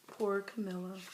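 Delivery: ordinary delivery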